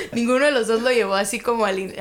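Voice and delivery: usual speaking voice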